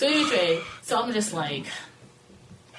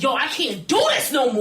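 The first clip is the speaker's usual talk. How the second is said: loud, raised cry of overwhelm; exasperated outburst; 7 semitones higher, 5 dB louder than usual. Retold or imitated. Storyteller re-enacting her breaking point with three men, exasperated shout. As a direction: blurt it out, at the end of your rope. Retells being overwhelmed, listener says stressing.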